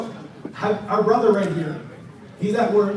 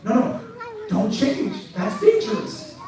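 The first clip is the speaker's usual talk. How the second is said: mock outrage, higher and emphatic by the end; sarcastically scandalized, building to higher-pitched exclamations; sarcastic; 3 semitones higher than usual. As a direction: feign alarm at the very idea of changing. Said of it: "I'm being sarcastic".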